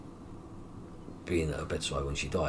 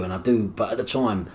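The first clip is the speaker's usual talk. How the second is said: normal talking voice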